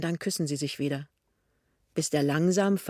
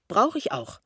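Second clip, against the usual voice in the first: higher, self-assured reply with a falling end; proud; 5 semitones higher than usual. A narrator's reading. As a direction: state it with a touch of pride. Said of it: "sagt sie stolz". (ein wenig stolz)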